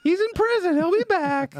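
hammed-up imitation of a defensive manager; mock club manager. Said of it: "the general manager of this club".

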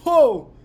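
sharp high pained oh; pained gasp. Real, not acted. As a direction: yelp as the heat hits. Reacting to chili burn.

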